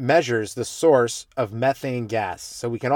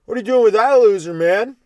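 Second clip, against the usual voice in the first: put-on loud, higher drunk guy hitting on a girlfriend; 8 semitones higher, 8 dB louder than usual. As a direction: play a sloppy loud drunk on the bus. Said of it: "there'd be some drunk guy like".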